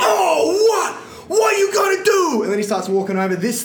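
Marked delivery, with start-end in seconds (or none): 0.0-2.4 high, loud taunting shout; retold shout of the man squaring up; aggressive stranger. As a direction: challenge him like a street bully.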